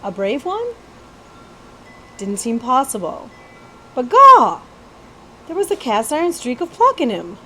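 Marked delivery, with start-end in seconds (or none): 3.9-4.6 a loud, high gasp of wonder; amazed exclamation. A narrator's reading. Jemmy amazed at the prince's pluck, loud high exclaim. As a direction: blurt it out in sheer amazement.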